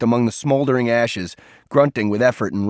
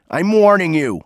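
loud, high threat; raised voice, pitched up, threatening; shouted warning; 6 semitones higher, 5 dB louder than usual. A narrator's reading. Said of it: "he shouted".

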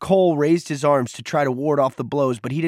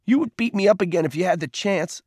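high-pitched accusation; heated challenge; growing angrier; 7 semitones higher than usual. A narrator's reading. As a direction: press the accusation with mounting heat. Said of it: "his voice growing louder".

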